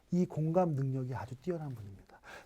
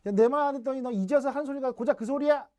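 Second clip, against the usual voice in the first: high, loud scolding jab; 7 semitones higher, 4 dB louder, faster than usual. Retold or imitated. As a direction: reproach them for not listening. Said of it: "평가 빈정하기예요". (높고 큰 소리로 나무라는 말투)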